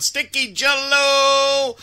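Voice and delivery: high, slow, sustained name call; drawn-out announcer flourish. Pretend. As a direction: stretch the last vowel like a hammed-up announcer. Host hams up a shout-out name.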